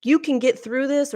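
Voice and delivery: high, sing-song mock encouragement; mock cheerful platitude. Mocking how others cheer up a friend, high sing-song. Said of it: "she didn't try to".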